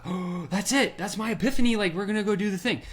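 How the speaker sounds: excited epiphany. Retold or imitated. Moment of sudden epiphany.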